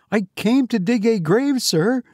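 high, frightened stammer. A narrator's reading. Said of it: "stammered Gabriel".

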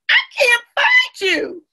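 high, loud, slow singsong call; playful calling out. A narrator's reading. Amma giving up the search for friends, calling out. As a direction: draw it out so hiders hear. Seeker calls to hidden friends.

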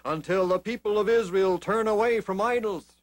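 stern prophet's warning. Acted. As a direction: proclaim it sternly to the king. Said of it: "he told the king".

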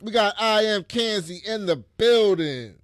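loud, high, drawn-out MC introduction; hype announcer intro. Real, not acted. Introducing a podcast co-host, hype announcer style. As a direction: announce the host to a roaring crowd. Hypes up second co-host's introduction.

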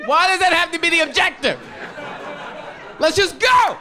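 loud, high exasperated yelling; ranting at his friends about pumpkin picking, shouting. Real, not acted. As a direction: shout them down in disbelief.

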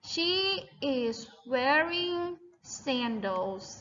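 "she is wearing sandals" spaced word by word; slow model sentence. Shown as a demonstration. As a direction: lead the choral answer slowly. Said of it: "ตอบพร้อมกันว่า". (พูดช้าๆ นำให้นักเรียนตอบพร้อมกัน)

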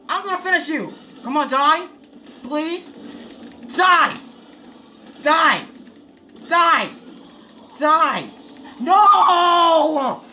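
high, strained cries at the enemy; desperate pleading. Real, not acted. Desperately trying to finish boss.

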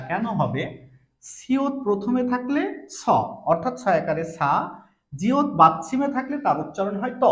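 ordinary delivery

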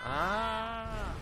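high drawn-out scream. Real, not acted. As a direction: let out a frightened scream. Screams at nightmare.

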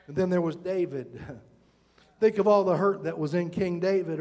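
ordinary talk, nothing marked

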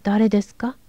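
ordinary delivery, nothing marked

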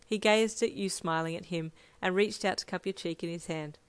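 plain reading voice

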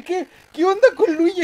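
high, loud disbelieving question; baffled outcry. Real, not acted. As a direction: shout it at the screen, amazed. Baffled by Luigi's luck.

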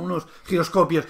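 ordinary talk

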